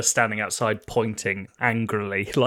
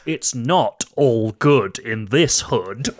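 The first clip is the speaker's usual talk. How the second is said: slow headline read; 2 semitones lower, much slower than usual. Pretend. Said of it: "newspaper headline that says".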